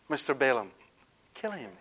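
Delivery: ordinary talk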